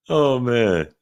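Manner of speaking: usual speaking voice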